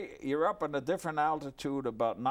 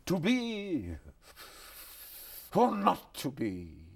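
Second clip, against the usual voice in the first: put-on old-fashioned Hamlet; 5 semitones higher, 3 dB louder, slower than usual. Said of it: "doing Hamlet for me".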